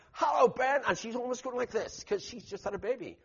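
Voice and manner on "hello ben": high, loud call across the street; mock scream of hello; screamed greeting. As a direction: shout his name with delight. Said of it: "she screams out".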